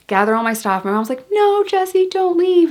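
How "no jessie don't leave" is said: very high-pitched mock plea; imitated pleading mom. Mom begging daughter to stay on Christmas, imitated high plea.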